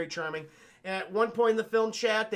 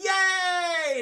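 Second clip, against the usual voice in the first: much higher, loud squealing yay; overjoyed cheer; 12 semitones higher, 8 dB louder than usual. Retold or imitated. Sonic cheering when Marsden agrees, high and loud. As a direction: cheer like a thrilled kid. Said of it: "And so it's like".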